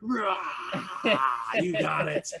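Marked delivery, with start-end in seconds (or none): 0.0-1.5 long rasping tiger growl; tiger roar. Shown as a demonstration. Coach roaring with Millie on the push-up, tiger roar. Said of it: "we're gonna roar".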